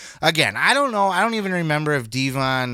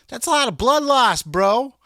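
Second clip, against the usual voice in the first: much higher and louder, disbelieving; shocked outburst; 9 semitones higher, 7 dB louder than usual. Retold or imitated. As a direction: blurt it out in alarm. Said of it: "I'm like".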